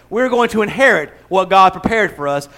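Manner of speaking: ordinary delivery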